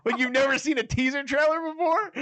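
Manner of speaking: very high, incredulous comeback; mock exasperation. She scoffs at him over the trailer, mock exasperation. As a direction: pitch it right up in play-acted disbelief.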